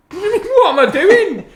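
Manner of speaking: high, loud, drawn-out disbelief through laughter; incredulous comeback. Real, not acted. Host challenged over his model pick, laughing incredulity. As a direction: throw the question back, squealing and laughing.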